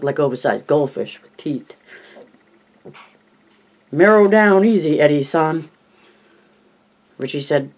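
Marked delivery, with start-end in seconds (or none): 3.9-5.7 hammed-up Chinese accent, teasing; mock Chinese accent. Richie teasing jumpy Eddie in a mock Chinese accent. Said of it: "doing his Chinese coolie voice".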